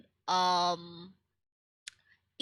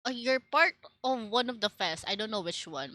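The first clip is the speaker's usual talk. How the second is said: ordinary talk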